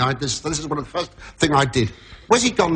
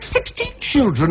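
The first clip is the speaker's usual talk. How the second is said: mock disbelief; 9 semitones higher, slower than usual. Disbelief at sixteen kids.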